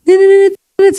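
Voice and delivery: high, rapid beeping ringtone; imitated phone ring. Preacher mimics her phone suddenly ringing, high beeps. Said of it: "telepon saya bunyi".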